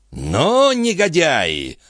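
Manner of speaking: high, drawn-out cry of outrage; angry shout. A narrator's reading. Stationmaster berates his grooms, angry high shout. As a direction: stretch it out high, scolding the men.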